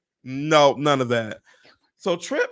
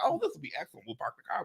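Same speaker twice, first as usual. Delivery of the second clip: light, high and soft, acted; naive optimism; 6 semitones higher, 10 dB quieter, much faster than usual. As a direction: play the pleased traveler with an easy plan. Retold naive optimism about parking.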